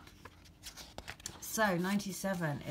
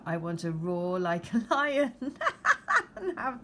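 laughing; 4 semitones higher, 5 dB louder than usual. Real, not acted. Laughs mid-reading.

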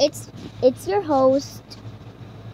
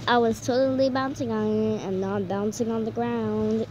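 ordinary talk, nothing marked

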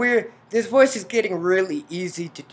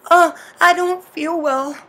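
higher, louder groggy complaint; unwell heroine; 11 semitones higher, 9 dB louder than usual. Acted. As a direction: play a dizzy girl feeling sick. Heroine drugged and dizzy.

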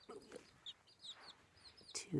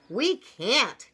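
raised, louder storybook cry; frightened cry; 7 semitones higher, 9 dB louder than usual. A narrator's reading. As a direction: cry out as scared little chickens. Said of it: "cried the little chickens".

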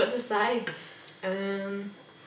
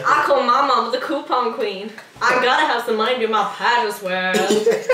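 loud little pageant girl impression; put-on pageant kid, projecting to the camera; Honey Boo Boo; 5 dB louder than usual. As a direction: imitate a brash child beauty queen, louder. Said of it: "Honey Boo Boo".

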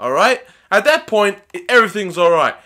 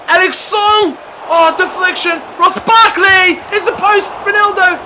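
high, loud shouts in a rapid scramble; frantic excited yells; 11 semitones higher, 5 dB louder than usual. Real, not acted. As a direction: call every touch as chaos unfolds. Goalmouth scramble.

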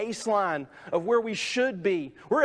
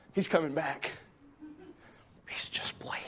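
low, soft, then whispered; hushed disciples; 6 semitones lower, 10 dB quieter than usual. Acted. Disciples staring at the sky, hushed whisper. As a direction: whisper it like you're sure he'll return. Disciples waiting after ascension.

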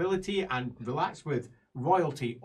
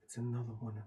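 hushed dismay; 5 semitones lower, 16 dB quieter than usual. Acted.

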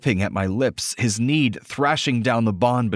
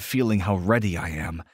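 plain reading voice

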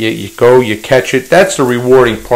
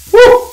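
very high short "woo"; high whoop; 3 dB louder than usual. Real, not acted. Cook taking hot dish out, high whoop. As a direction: let out a quick high-pitched whoop.

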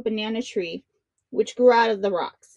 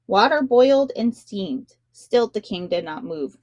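plain reading voice

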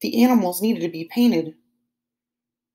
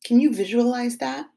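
usual speaking voice

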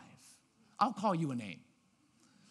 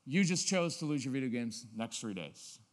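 ordinary delivery

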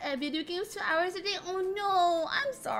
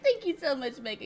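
ordinary talk

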